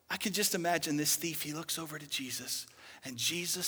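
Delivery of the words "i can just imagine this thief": ordinary talk, nothing marked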